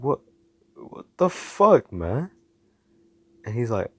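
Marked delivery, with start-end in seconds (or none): 0.0-2.3 stammer, then a high, loud, drawn-out outburst; high-pitched, disbelieving "what the hell"; exasperated disbelief. Acted. Hero spots the villain he already caught, exasperated disbelief.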